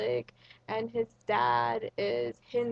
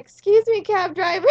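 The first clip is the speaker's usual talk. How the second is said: high, loud, laughing call to the driver; hammed-up polite hail breaking into laughter; giggly mock politeness; 11 semitones higher, 12 dB louder than usual. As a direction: put on a sweet high voice, then crack up.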